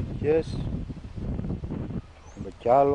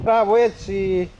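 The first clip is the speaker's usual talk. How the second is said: drawn-out praise; 7 semitones higher, 6 dB louder, slower than usual. Real, not acted. Praising the animal.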